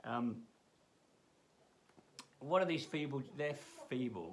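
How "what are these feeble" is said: mocking tone. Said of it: "he's just mocking them".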